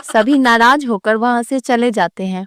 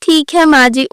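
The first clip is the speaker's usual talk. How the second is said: usual speaking voice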